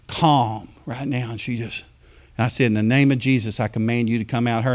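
quiet command. Retold or imitated. Reenacted quiet deliverance command.

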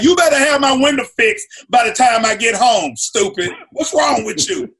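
put-on a touch of an angry girlfriend. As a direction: demand the window gets fixed. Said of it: "she gonna be like".

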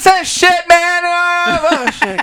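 high mock whine; squeaky imitation of a friend; imitating John. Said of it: "John would be like".

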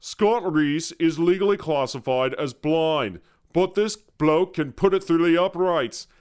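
hammed-up British broadcaster's accent; thick British accent. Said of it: "Dude had a thick British accent".

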